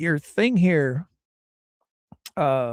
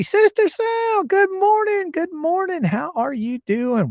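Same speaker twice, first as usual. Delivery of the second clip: sing-song greeting; 13 semitones higher than usual. Real, not acted.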